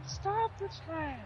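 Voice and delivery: very high, slow squeaky yell; mock yell. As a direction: imitate a frantic coach yelling in falsetto.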